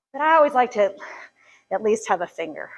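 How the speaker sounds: breathless and winded; speaking out of breath. Real, not acted. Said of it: "I'm out of breath".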